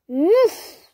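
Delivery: high, loud oof of impact; the pea getting squashed; squashed grunt. A narrator's reading. Pea caught in trap.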